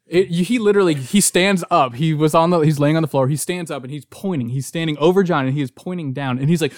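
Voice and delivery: ordinary talk, nothing marked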